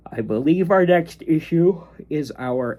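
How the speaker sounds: talking through a yawn; sleepy words mid-yawn. Real, not acted. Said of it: "Sorry that I keep yawning".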